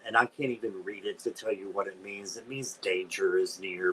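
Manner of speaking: usual speaking voice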